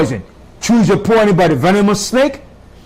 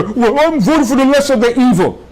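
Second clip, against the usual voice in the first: mock voter; 6 semitones higher than usual. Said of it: "Some people might say".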